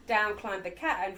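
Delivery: ordinary delivery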